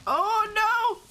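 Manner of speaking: high, loud yelp of panic; panicking gamer. Real, not acted. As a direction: cry out as it charges. Monster chasing the player.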